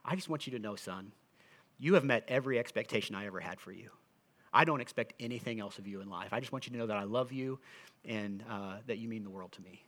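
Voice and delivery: soft, hushed words of love; tender father. Retold or imitated. Dad telling his son he loves him, soft and tender. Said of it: "and he said".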